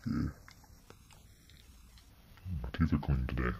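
ordinary talk

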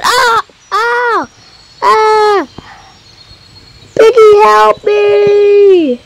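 high, slow, hammed-up cry for help; mock panic. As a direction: squeal for Piggy in fake terror. Character screams for the pig.

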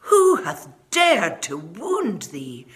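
slow, loud, outraged giant; a giant roaring at whoever hurt the child; angry demand. Acted. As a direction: thunder it out slowly in fury. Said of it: "his face grew red with anger".

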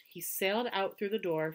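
usual speaking voice

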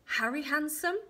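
high, loud rising question; hopeful guess. Acted. Queen tries an unlikely name, questioning. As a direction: offer the name with a lift.

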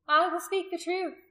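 high, loud resolve; loud declaration. A narrator's reading. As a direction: declare it so all can hear. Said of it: "said loud enough for all".

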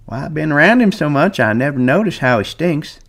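put-on high old voice, apologetic; apologetic Candy. A narrator's reading. Old Candy apologizing to Carlson for his dog. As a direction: soften it, sorry. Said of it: "he apologized".

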